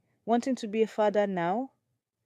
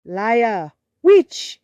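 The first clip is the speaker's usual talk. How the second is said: screamed, much higher and louder; furious shout; 4 semitones higher, 11 dB louder than usual. Acted. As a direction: hurl the accusation with full rage. Wife accusing rival of witchcraft.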